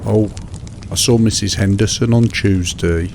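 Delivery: ordinary delivery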